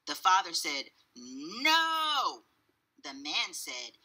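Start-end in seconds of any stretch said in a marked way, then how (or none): 1.1-2.5 high, drawn-out no; stubborn refusal. A narrator's reading. Father argues with the cashier, stubborn. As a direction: dig your heels in.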